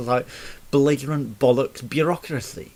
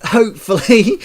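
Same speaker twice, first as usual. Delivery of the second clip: hopefully, said much louder and higher with a rise; loud hopeful cry; 9 semitones higher, 13 dB louder than usual. Real, not acted. Ranting about the council, loud rising hopefully. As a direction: belt out hopefully, rising and mocking.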